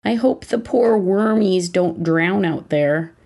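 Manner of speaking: slow, pouting little sad-creature voice; sad animal voice. Retold or imitated. Son worrying about worms in rain, slow sad animal voice. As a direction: lip out, play a forlorn little animal. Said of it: "in his sad animal voice".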